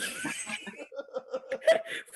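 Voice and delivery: high, breathless, almost crying laughter. Real, not acted. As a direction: laugh till you can barely breathe. Laughing hard at the story.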